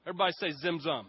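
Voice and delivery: ordinary talk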